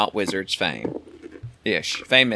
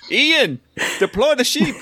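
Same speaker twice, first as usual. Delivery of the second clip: high-pitched comic command; mock calling out; 12 semitones higher than usual. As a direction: call out to a farmhand, hammed-up. Acting out a storm alarm call.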